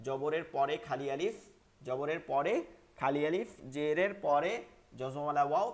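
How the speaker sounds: ordinary delivery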